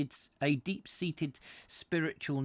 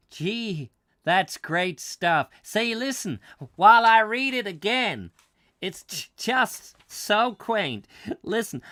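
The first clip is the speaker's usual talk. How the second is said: put-on American accent; 8 semitones higher, 8 dB louder than usual. American tourist raving to his wife about the poem, in an American accent. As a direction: gush like a New York businessman. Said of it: "I should do this in an American accent".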